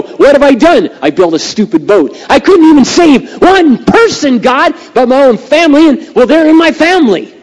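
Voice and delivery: high, loud cry of exasperation; hammed-up despairing outburst; anguished lament. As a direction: wail over a wasted life. Imagined Noah lamenting a failed life.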